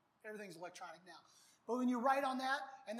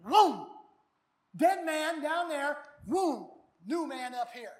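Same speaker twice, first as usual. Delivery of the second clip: loud joyful whoops and a slow proclamation; shouted celebration, slow and loud; excited whoop; 3 semitones higher, 10 dB louder, slower than usual. Pretend. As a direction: celebrate like a new believer bursting out. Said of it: "publicly they're saying".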